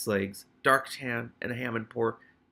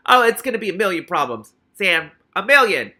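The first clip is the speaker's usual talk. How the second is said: louder, higher exclaiming; loud aside; 7 semitones higher, 12 dB louder than usual. Real, not acted. Reader exclaiming to Sam about the trouble, loud. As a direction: exclaim it to a friend.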